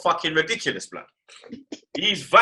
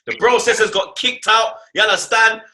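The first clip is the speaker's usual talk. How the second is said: higher, louder, laughing rant; excited rant; 6 semitones higher, 4 dB louder than usual. Real, not acted. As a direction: rant with mock outrage. Excited rant about the team.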